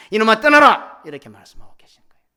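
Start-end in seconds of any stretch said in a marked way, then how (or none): 0.1-1.0 high, full-force shout; anguished outcry. Acted. God crying out to his wayward child, anguished shout. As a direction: roar it from a tormented heart. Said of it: "피를 토하는 심정으로".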